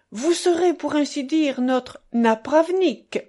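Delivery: a hint of a jovial joker; lightly voiced buffoon. Buffoon retells his own pun.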